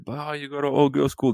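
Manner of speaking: ordinary delivery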